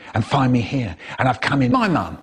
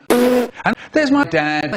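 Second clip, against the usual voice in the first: high and slow, drawn out; comic reveal; 9 semitones higher, slower than usual. Acted. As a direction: stretch it out high for the big reveal.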